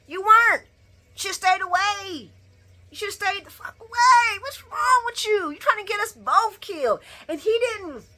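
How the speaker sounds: high, heated scolding; frustrated rant. Real, not acted. Ranting at Dex, high and heated. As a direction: tell him off hard.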